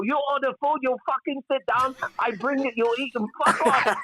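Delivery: high, rough mock of a surly restaurant owner; rude restaurant owner. Restaurant owner ordering diners around, high mock bark. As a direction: bark the orders at the customer in a put-on voice way above your own. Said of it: "They go".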